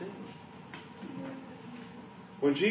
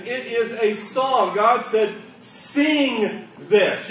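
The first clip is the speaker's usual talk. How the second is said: preaching; 6 semitones higher, 6 dB louder than usual. Acted. Preaching at full force.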